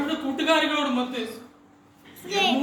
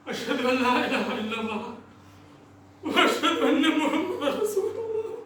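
acted tearful prayer sliding into sobs; grieving father sobbing through the words; weeping prayer. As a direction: cry out to God and the lost child. Father prays over his dead daughter.

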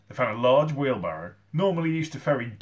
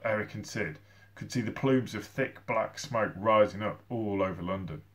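plain reading voice

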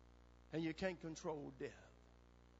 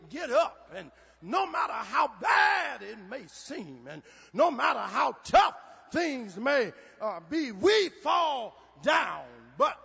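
loud, high sermon shout; booming, emphatic church preacher; full-force preaching; 4 semitones higher, 13 dB louder than usual. Real, not acted. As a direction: preach it out, stretching the big words.

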